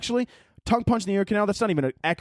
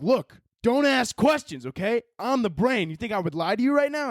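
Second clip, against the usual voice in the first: a hint of a pushy inner voice, higher and slowed; bossy brain voice; 8 semitones higher than usual. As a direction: play your brain insisting it knows best. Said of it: "My brain's like".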